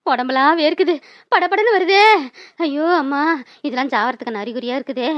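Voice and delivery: weak, low and soft moaning; feeling faint. Acted. Aunt reads sweat as death coming, weak moan. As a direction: let the strength drain out.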